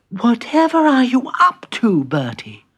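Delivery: high-voiced, mildly sleepy question. Woken Peter questioning Bertie, a touch sleepy. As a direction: start high and loud, sink drowsily on the name. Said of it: "said Peter sleepily".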